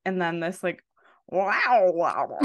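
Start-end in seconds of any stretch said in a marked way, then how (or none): 1.3-2.4 light New Jersey accent impression; hint of a Jersey drawl on wow. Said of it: "your version of a New Jersey accent".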